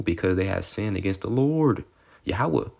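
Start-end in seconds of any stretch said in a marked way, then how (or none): none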